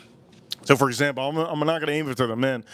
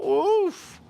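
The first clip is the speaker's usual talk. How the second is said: disgusted oof. Acted. Reacting to a girl's smell.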